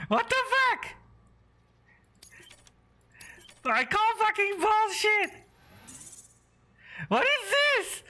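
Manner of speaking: high, yelling outrage at the grade; furious rant. Real, not acted. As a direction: scream at the screen in disbelief. Raging at a low grade.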